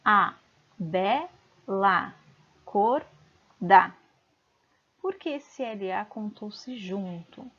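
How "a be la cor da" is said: punchy single syllables "A, be, la, cor, da", long pauses; each syllable of "A, be, la, cor, da" standing alone; separated by pronunciation. Shown as a demonstration. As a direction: count out "A, be, la, cor, da" one by one. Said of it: "vamos separá-las pela pronúncia".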